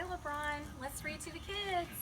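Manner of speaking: ordinary delivery, nothing marked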